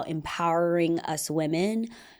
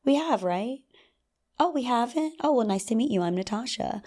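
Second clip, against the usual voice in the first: higher, bright, playful opener; flirty greeting; 6 semitones higher than usual. Shown as a demonstration. Approaching a guy at a party, flirty hello. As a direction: act casually surprised to meet him. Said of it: "go up to him and say".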